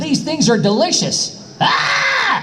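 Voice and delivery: slow, very high hammed-up line that ends in a scream; silly screamed filler; added dub scream. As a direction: tack a yell on to fill the extra flap. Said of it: "You add a scream if there's an extra flap".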